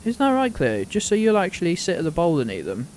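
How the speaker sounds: high, loud fond voice to the dog; high singsong dog-owner voice; pet talk. Real, not acted. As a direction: coo at Cleo as if she answers. Asking the dog Cleo.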